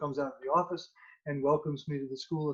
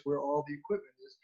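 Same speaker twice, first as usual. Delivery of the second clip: ordinary delivery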